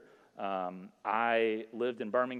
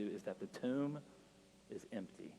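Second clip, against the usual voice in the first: closing prayer. Acted. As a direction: lead them gently into prayer. Closing sermon and prayer over music.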